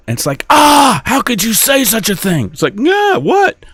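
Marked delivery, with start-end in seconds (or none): 0.5-2.5 high-pitched scandalized gasp and protest; mock shock. Acted shocked wife in quarrel. 2.8-3.6 high, startled comeback; mock bafflement. Acted baffled reply in quarrel.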